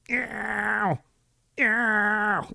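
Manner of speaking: high meows, each falling away; mock cat meowing to play; imitating the cat's meow. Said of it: "He's like".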